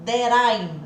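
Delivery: "that I'm" with a flapped T like "dér aim". Shown as a demonstration. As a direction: run "that I'm" together, T as a quick flap. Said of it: "ao invés de that I am here". ("that I'm" com o T virando R rápido)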